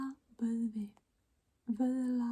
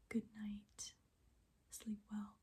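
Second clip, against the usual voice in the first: soft goodnight; 2 semitones lower, 15 dB quieter than usual. Real, not acted. Bedtime goodnight.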